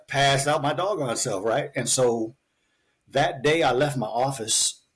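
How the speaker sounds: normal talking voice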